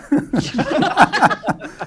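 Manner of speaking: loud, very high cackling; big squealing laughter; hysterical laugh. Real, not acted. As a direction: keep cracking up helplessly. Table keeps laughing at joke.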